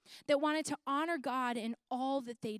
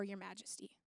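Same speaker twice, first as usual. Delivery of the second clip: whisper; 6 semitones lower, 13 dB quieter than usual. Acted. Quoting the boys to king.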